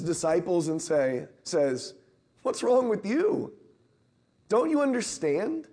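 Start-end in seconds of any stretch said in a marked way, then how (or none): 2.4-5.7 higher, an exasperated gentle rebuke. Retold or imitated. Jesus rebuking his disciples, an exasperated gentle rebuke. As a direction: shake your head at them as you ask.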